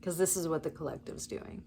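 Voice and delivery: normal talking voice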